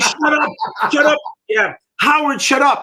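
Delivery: high, laughing mock shout of shut up; mock yell at the DJ. Mocking the radio DJ for talking over songs, laughing high yell.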